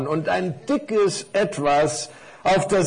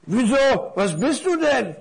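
curious big parcel; 8 semitones higher than usual. Acted.

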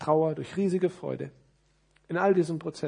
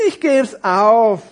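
very slow, drawn-out despair; exasperated high character voice; sighed resignation; 8 semitones higher, 15 dB louder, much slower than usual. Acted. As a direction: give up with a loud, hammed-up sigh. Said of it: "seufzte die Krawatte".

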